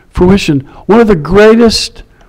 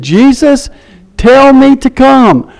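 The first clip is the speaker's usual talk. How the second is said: higher, slowly drawn-out call; retold calling out; 6 semitones higher, slower than usual. Peter asking Jesus before stepping out, imitated calling out. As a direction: imitate a measured call from afar. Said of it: "he yells out and says".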